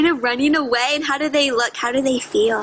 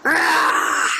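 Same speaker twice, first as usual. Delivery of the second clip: bear roar. Shown as a demonstration. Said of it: "Can you give me a bear roar?".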